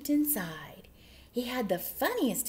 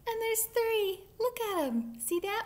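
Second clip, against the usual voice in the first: high excited; 11 semitones higher than usual. Real, not acted. Excited reveal.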